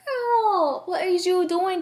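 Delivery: high mock scold at herself. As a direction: scold yourself in a high pitch.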